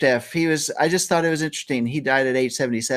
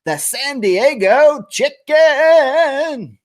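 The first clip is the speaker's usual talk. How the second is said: big announcer reveal; 10 semitones higher, 6 dB louder, much slower than usual. Acted. Announcing a mascot.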